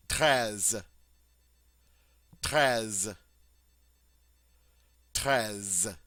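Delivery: treize with a throaty R and short E; French treize. Shown as a demonstration. Teacher modeling treize for students, clear French. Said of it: "on dit".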